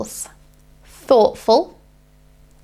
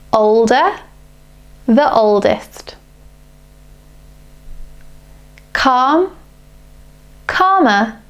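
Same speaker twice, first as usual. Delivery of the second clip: slow reading model; slower than usual. Shown as a demonstration. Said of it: "So let's read them together".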